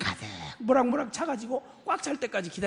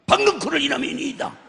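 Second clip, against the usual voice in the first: mock indignant retort; 5 semitones higher, 6 dB louder than usual. Jonah's blurted answer to God.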